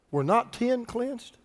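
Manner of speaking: unhurried, solemn question; measured scripture question. Acted. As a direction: read it slowly and quietly, leaving it hanging. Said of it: "Then Jesus answered".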